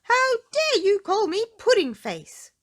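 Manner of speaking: very high, loud outraged cry; indignant cry. Acted. Mister Tickle confronting Miss Trouble, a high outraged cry. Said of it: "cried Mister Tickle".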